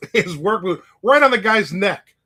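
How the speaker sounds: usual speaking voice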